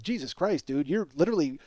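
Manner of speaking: normal talking voice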